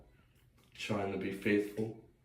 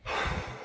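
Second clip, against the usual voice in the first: long, voiceless sigh. Real, not acted. Speaker with minutes left to fill, sighing. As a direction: let out a long breath. Sighs before checking time left.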